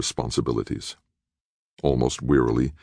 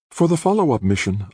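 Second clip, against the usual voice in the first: exclaiming; 11 semitones higher than usual. A narrator's reading. Aditi jumping in eagerly about the follow-up mission, excited exclaim. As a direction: blurt it out, thrilled. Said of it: "Aditi exclaimed".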